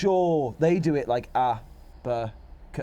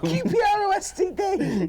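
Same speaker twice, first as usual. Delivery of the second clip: mocking shout; 14 semitones higher, slower than usual. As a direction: yell the letters back at him, mocking. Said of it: "Shut up!".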